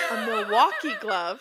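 loud, amused and laughing through it. Real, not acted. Telling how many gloves she put on, laughing loudly. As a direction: say it over real laughter.

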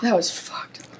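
soft, breathy whispered aside. Real, not acted. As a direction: whisper it to yourself. Gasping aside after the eating.

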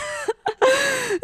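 high, breathy burst of giggling; a light laughing squeal; high giggle. Real, not acted. Laughing at own situation.

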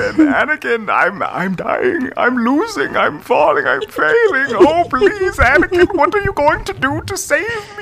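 put-on high, pleading, wailing old man; whiny dying villain. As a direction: beg for help in a high whine. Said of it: "he's like".

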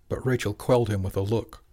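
plain reading voice

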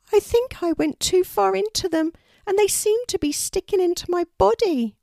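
very high, loud bird voice; pained little raven. Acted. Poppy tells Kelty the brambles hurt, high pained voice. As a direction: complain of pain in a high squeak.

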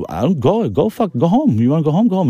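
high, exasperated brush-off; dismissive retort. Retold or imitated. Telling the quitting cook to leave, dismissive retort.

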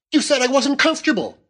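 put-on high, fast accusing young-wife voice; wife's petulant retort. A narrator's reading. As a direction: fire back, hurt and childish. Wife accuses husband in quarrel.

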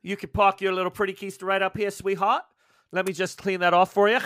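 lightly voiced Aussie barkeep flirting; Aussie barmaid. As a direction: Clarice lightly sweet-talking Faye in Aussie accent. Barmaid Clarice greets Faye.

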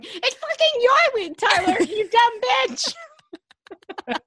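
mock-outraged high, loud yell; exasperated listeners. Host imagines listeners yelling at the forgetful co-host, mock-exasperated yell. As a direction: yell it high like a fed-up fan. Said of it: "picturing people listening going".

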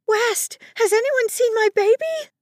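high, loud, frantic plea; panicked scream. A narrator's reading. Mother asking the crowd for her baby, screaming in panic. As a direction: cry out frantically to everyone around. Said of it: "panicked sound of a mother screaming".

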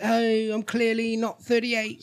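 slightly drawn-out mock innocent voice; mock innocence. Co-host mocking her denial, mock innocent. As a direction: lightly play the innocent.

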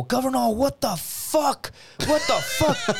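high, slow shocked yell; friends shouting. Retold or imitated. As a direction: shout it across the bar. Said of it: "and they go".

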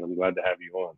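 ordinary talk

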